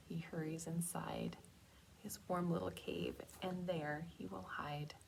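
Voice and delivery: soft whispered rhyme. Acted. Teacher whispering the hiding bear rhyme to children. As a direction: hush it like hiding away.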